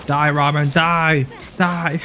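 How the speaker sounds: loud gleeful battle yell; battle cry shout. Acted. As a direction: yell it as you charge in. Killing Rahman in game.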